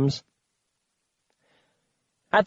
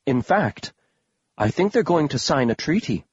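ordinary delivery, nothing marked

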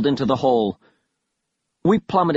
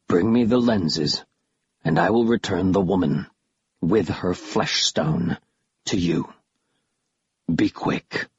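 menacing creature; 6 semitones lower than usual. A narrator's reading. Killiman offers to trade her for the lenses, low menacing creature. Narrator voices Killiman, the villain.

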